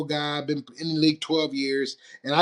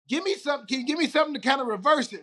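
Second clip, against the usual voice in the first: higher, hurried plea to the doctor; quick, high-pitched request; pleading quote; 9 semitones higher, much faster than usual. Retold or imitated. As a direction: ask it urgently, pitched up.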